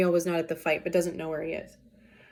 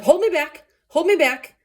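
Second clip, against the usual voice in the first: higher, louder hold me back; mock bravado; 8 semitones higher, 6 dB louder than usual. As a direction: fake a fighter begging to be restrained. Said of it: "he is saying the equivalent of".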